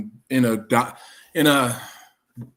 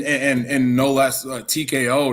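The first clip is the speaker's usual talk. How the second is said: ordinary delivery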